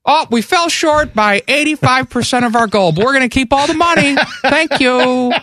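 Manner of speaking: high, pleading fundraiser voice; mock pledge drive voice. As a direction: beg like a radio host. Mock radio station pledge plea.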